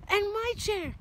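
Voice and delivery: high, loud mommy bear; indignant mommy bear. Acted. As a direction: chime in shrilly as the mother bear. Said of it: "Mommy bear".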